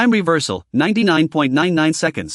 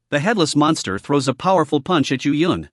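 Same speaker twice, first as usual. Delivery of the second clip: ordinary delivery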